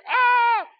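high, loud mock scream of terror; terrified yelp. Preacher re-enacting his shock as his hand lands on her knee, terrified yelp. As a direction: let out a comic shriek of panic. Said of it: "Because I'm terrified".

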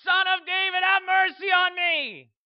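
very high, very loud drawn-out scream for mercy; all-out desperate yelling. Acted. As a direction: yell at the top of your lungs. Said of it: "screaming and yelling at the top of his lungs".

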